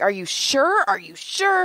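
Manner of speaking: high, insistent questioning; disbelieving plea. Retold or imitated. Retelling her disbelieving question.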